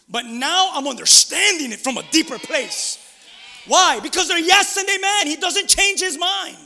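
shouted, high-pitched preacher's climax; full-force preaching. Real, not acted. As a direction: build to a loud, soaring proclamation.

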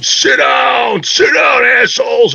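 high, loud angry yell; crowd yelling. Retold or imitated. Annoyed fans behind them yelling, high and loud.

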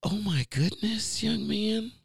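high, slow, sweet church lady voice; church lady impression. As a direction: imitate a surprised older church lady. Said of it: "that is how Caucasian ladies talk".

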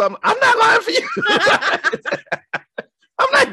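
high, laughing outburst; laughing shout. Retold or imitated. Brother refusing to lie for his sister, laughing shout.

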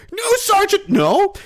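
high panicked cry of a scared recruit; whimper-shout a frightened plea; terrified recruit. Retold or imitated. A young recruit pleading with his sergeant, crying out. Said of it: "It's like".

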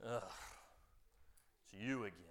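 low, fed-up principal grumble; weary principal. Retold or imitated. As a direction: sigh at the kid like a tired principal. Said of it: "and he goes".

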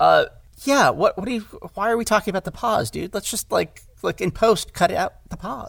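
high, incredulous mock outrage at a fussy director; exasperated actor. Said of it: "And it's like".

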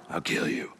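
breathy whisper of a murder threat; mock whispered menace. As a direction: hiss it under your breath. Brothers muttering threat.

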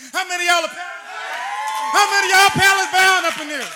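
loud call to the congregation; rising shout to the crowd. Real, not acted. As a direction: call out to the whole room, loud. Preacher calling out to congregation.

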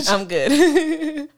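laughing through the words, slow. Real, not acted. Joking about giving up on life.

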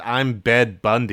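"Bed Bundy" said slowly and clearly as the correction; correcting the name. Shown as a demonstration. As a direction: lean on "Bed" and spell out "Bundy". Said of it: "He says".

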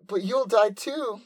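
put-on high-pitched worried girl; high anime girl. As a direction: cry it out high and slow. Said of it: "And Nymph's like".

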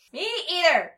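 high, loud, panicky yelp. A narrator's reading. Mungo Jerry yelping in panic at the flying bug. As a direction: yelp it out scared. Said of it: "yelped Mungo Jerry".

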